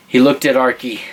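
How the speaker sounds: ordinary delivery, nothing marked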